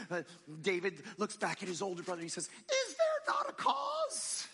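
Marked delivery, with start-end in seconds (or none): 2.5-4.5 put-on a twelve-year-old whose voice breaks mid-shout; breaking boy's voice. Young David to his older brother, voice cracking into a shout. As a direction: voice cracking way up high, slow and shouted. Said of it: "in his little twelve-year-old voice, probably changing".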